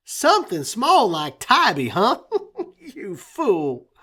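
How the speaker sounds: high, chuckling mockery; laughing tease. A narrator's reading. Old friend teasing about Tybee, laughing. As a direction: laugh at the dream fondly.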